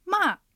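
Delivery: ま dropping in one go from high; sharp falling tone; fourth tone. Shown as a demonstration. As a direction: drive ま straight down. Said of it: "上から下に一気に下がります".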